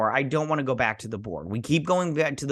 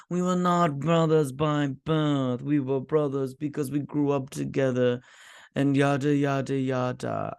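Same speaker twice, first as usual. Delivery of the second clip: imitated character voice. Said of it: "I don't like the voice he did".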